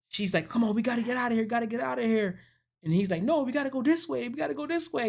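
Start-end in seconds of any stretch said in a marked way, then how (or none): 0.5-2.4 high-pitched retold urging; pressing, pitched up, not shouted; mock urgent plea. Woman urges escape. 3.2-5.1 very high, hurried retold argument; squeaky-high pleading at normal volume; mock panicked insistence. Man insists on other route.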